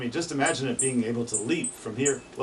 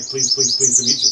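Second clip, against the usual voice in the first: loud emphatic bird mnemonic; chestnut-sided warbler call; 11 dB louder than usual. Shown as a demonstration. Teacher compares warbler songs for listeners, loud chant. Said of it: "Sounds a lot like".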